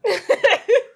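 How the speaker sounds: high, loud laugh; sudden loud laugh; loud burst of laughter. Real, not acted. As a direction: crack up at the joke. Bursts out laughing at quest joke.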